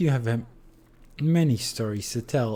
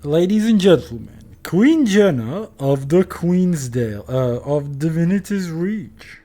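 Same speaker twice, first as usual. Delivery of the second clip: stiff ceremonial announcing; 5 semitones higher, 8 dB louder than usual. Pretend. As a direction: announce her like a court herald. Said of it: "jde ti to trošku líp".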